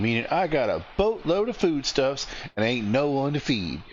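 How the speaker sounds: put-on high-pitched hearty Southern accent. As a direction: play a cheerful Southern innkeeper in a high Southern accent. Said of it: "giving her a southern accent".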